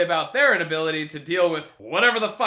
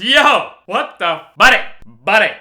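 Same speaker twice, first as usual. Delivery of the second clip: indignant callout; 3 semitones higher, 10 dB louder than usual. Retold or imitated. Billy confronting the guy who shushed them, indignant callout. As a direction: call him out loudly. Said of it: "Billy looks over at this guy and goes".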